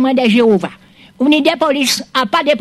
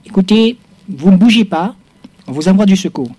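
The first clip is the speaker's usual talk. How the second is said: phone gendarme voice. Acted. Joue le gendarme au téléphone.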